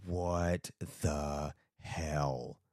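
very slow, low and soft; slowed, hushed stunned disbelief; bewildered what the hell. Retold or imitated. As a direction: drag it out in disbelief. Retold bewildered reaction, slow.

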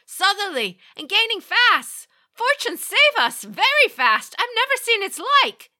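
high, loud frantic shouting; panicked lookout. A narrator's reading. Lookout shouting in panic to the captain. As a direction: scream the warning in rising terror.

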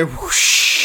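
unvoiced rush of air; whooshing flight noise. [name] flies away from the podcast, long airy whoosh. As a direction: blow a long whispery whoosh.

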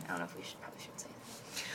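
very quiet, low, half-whispered; barely audible murmur; hushed aside. Real, not acted. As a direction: drop to a near whisper, as if off the record. Aside about withholding a name.

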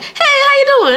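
very high, hammed-up Southern hello; squealed, sing-song Southern imitation; Southern stranger's greeting. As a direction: exaggerate a gushing Southern greeter. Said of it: "somebody be like".

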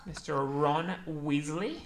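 slow, drawn-out mock British; British accent. Friend trying Harry Potter names, slow British accent. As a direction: stretch the name in a British accent. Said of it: "like, a British accent".

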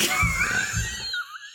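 shrieking burst of laughter; high squealing laugh. Real, not acted. Laughing fit.

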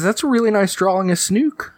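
high-pitched mother voice; encouraging mom. Acted. Mom admiring child's drawing, high encouraging voice. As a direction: praise a child, pitched well up. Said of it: "she says".

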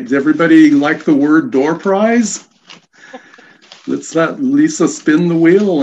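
loud, upbeat game-show host energy; hyped giveaway announcement. Real, not acted. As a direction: pump up the crowd for the prize. Hosting a door prize giveaway.

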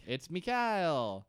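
"Mikhail" slow, as mee-KAH-eel; Russian pronunciation. Shown as a demonstration. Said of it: "Not, not Michael. Mikhail".

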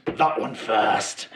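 slow, sinister villain line; menacing villain. Acted. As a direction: savour it like a cruel villain. Villain grins, points at target.

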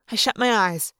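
usual speaking voice

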